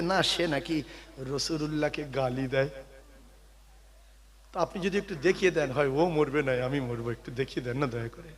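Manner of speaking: put-on soft, hushed boy's voice begging; a small boy quietly begging to be shown the enemy; young boy pleading. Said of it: "একটা বাচ্চা বলছে". (ছোট ছেলের মতো নরম গলায় অনুনয়)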